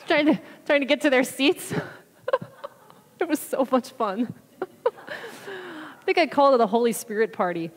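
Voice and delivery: talking through real laughter; laughing. Real, not acted. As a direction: giggle through the fond memory. Laughing while recalling the fun.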